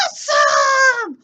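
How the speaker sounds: very high, loud, slow cheer; excited shout. Acted. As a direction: whoop it like a thrilled kid. Puppet cheers at new friend.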